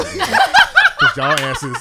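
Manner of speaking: high, loud, very slow laughing tease. Real, not acted. Host teasing his two cohosts about backup, laughing. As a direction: stretch it out while cracking up.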